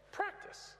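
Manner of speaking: normal talking voice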